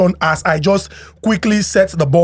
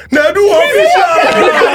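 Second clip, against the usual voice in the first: high, loud, stretched name call; hype announcer; 10 semitones higher, 4 dB louder, slower than usual. Acted. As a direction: announce him like a headliner. Hype host introduces guest.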